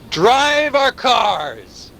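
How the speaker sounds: very slow, high and stretched; drawn out. Acted. Narrator listing what machines do, very slow. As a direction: drag out each word for effect. Repeats phrase, dwelling on it.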